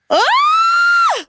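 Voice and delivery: a high, loud shriek of mock horror; loud high gasp-shriek; shocked shriek. Real, not acted. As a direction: squeal in delighted shock. Shocked reaction to daughter's line.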